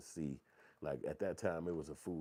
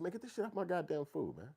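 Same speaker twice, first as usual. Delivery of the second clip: higher, exasperated demand; higher disgusted complaint; mock disgust; 11 semitones higher than usual. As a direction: push the food away in disgust. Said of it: "I said".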